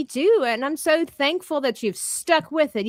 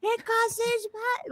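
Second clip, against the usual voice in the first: mock yelling; 12 semitones higher, 4 dB quieter than usual. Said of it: "I'm, like, yelling at people".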